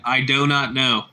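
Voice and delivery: each word set apart slowly; deadpan, slow and deliberate. Retold or imitated. Retelling his donut reply, slow deadpan. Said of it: "I was cracking up".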